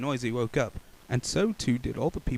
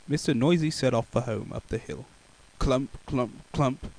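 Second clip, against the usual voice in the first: usual speaking voice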